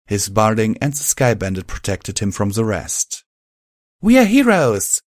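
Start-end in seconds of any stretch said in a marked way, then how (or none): 4.0-5.0 put-on much higher, louder young mare yell. A narrator's reading. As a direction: yell it up proudly. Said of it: "yelled up at us".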